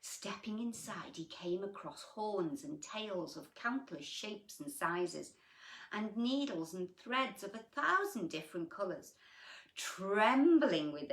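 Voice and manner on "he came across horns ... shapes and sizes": plain reading voice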